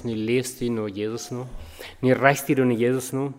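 ordinary talk, nothing marked

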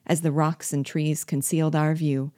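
usual speaking voice

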